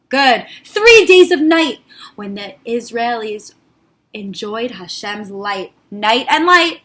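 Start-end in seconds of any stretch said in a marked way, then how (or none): none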